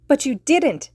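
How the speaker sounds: high loud shriek; a child shrieking in anger. Acted. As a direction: shriek it, stamping a foot. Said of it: "she shrieked".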